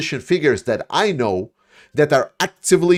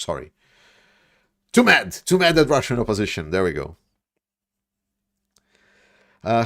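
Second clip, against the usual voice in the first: ordinary delivery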